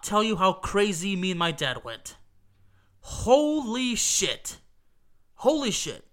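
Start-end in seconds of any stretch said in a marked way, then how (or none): 3.0-4.6 long, drawn-out, high "Holy shit"; retold stunned reaction. Fan recalling the late equalizer, slow stunned exclamation. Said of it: "really was the reaction". 5.4-6.0 short, clipped "Holy shit" with a falling end; quick retold reaction. Fan recalling the late equalizer, quick stunned exclamation. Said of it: "really was the reaction".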